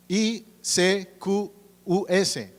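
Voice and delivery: slow, deliberate spelling of I C Q U S; spelled letter by letter. Shown as a demonstration. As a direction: spell out I C Q U S one letter at a time, slowly. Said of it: "la transliteración en castellano".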